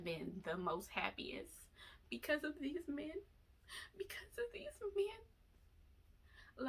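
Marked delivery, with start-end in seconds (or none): 2.1-6.7 sincere. Real, not acted. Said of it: "I can sincerely say".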